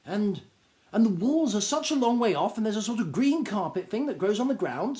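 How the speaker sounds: a high, eager little gnome voice, bursting with news; high excited gnome. Acted. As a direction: gnome tells a stranger about the store, high and eager. Excited gnome Angelo speaks.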